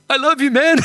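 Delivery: high, impulsive blurt; blurted affection. Retold or imitated. Retold blurted declaration of love.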